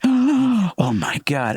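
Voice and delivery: high, slow, stretched-out gasped oh my God; drawn-out horrified oh my God; shocked gasp. Retold or imitated. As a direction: mimic a mother's dramatic phone shock. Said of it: "she'll let out a gasp".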